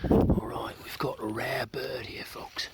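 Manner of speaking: slow, put-on British documentary narrator; mock British accent. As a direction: try a British accent, drifting toward Aussie. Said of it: "I should be doing this in a British accent".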